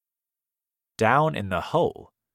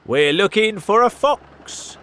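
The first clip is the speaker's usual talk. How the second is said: higher, louder farmer voice; gruff farmer reply; 12 semitones higher, 11 dB louder than usual. A narrator's reading. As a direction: answer up from the hole. Farmers answer villagers.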